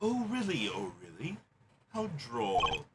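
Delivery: smug prosecutor. Acted. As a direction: savor the gotcha softly.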